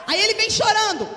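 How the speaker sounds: high, loud preacher's cry; preaching shout. Acted. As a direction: call it out to the whole church. Preacher acting the weeping believer. (grito de pregador)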